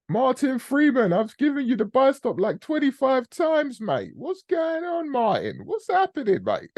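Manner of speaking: high, loud squeal of disbelief; mock exasperation. Host mock-scolding Martin for asking about the buy stop, squeaky exasperation.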